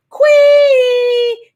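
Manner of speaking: very high, long-held loud note; showy intro flourish. Pretend. Host announces herself as motivational queen, high held flourish. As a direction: sing out the word high and hold it.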